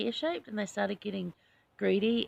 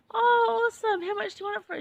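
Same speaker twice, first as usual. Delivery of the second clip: much higher and louder, thrilled; retold excitement; 13 semitones higher, 8 dB louder than usual. Thanking the honey lady for the jar, high and thrilled. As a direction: burst out high with delight.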